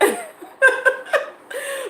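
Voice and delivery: squeaky high laugh; a real high-pitched laugh; high giggle. Real, not acted. Laugh after pageant answer.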